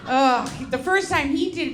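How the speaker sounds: normal talking voice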